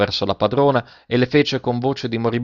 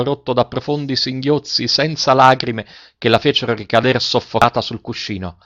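ordinary delivery, nothing marked

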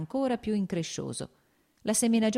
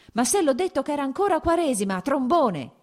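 high, loud exasperated outburst; angry shout; 10 semitones higher, 6 dB louder than usual. A narrator's reading. Lievin rebuking his manager over the oats, shouting. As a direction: shout it, ending on the insult. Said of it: "gridò".

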